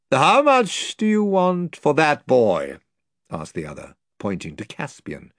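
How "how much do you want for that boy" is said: higher, slow lord's question; lordly demand. Acted. The lord asking Pug the price of Caspian, lordly. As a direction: press the price like a noble.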